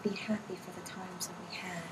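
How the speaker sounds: hushed whisper of a message. Retold or imitated. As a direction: breathe the words softly. Medium relays daughter's message softly.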